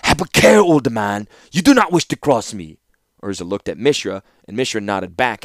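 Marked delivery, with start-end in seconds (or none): 0.0-2.8 put-on lightly clipped Argivian accent, loud warning. A narrator's reading. Young ruler warning the old warlord, clipped Argivian accent. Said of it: "answered in the clipped accent of an Argivian".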